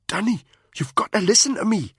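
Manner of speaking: worried soldier urging his friend; Spud, urgent. A narrator's reading. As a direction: push him to see sense, pressing. Spud pleading.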